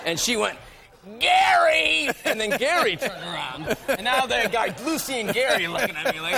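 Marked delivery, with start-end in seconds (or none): 1.2-2.1 high, loud hammed-up wail; imitated shrieking call for backup; retold shout for husband. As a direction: yell the name like an outraged wife. Wife calls husband for backup.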